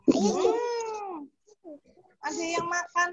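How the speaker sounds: usual speaking voice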